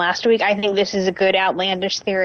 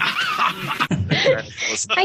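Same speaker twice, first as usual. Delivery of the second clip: laughing; 11 semitones higher, much slower than usual. Real, not acted. Laughs at failed accent.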